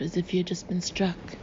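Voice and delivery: ordinary delivery, nothing marked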